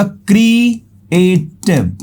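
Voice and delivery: "क्रिएटिव" slowly in three parts, क्री-ए-टिव; split into syllables. Shown as a demonstration. Teacher counting syllables for students, "क्रिएटिव" drawn out as क्री-ए-टिव. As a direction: stretch and separate each syllable of "क्रिएटिव". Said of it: "तीन syllable है".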